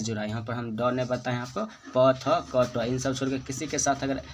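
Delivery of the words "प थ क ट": ordinary delivery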